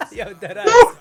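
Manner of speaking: high, slow laughing. Real, not acted. Laughing reaction.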